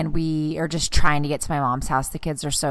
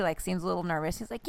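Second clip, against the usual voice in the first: ordinary talk, nothing marked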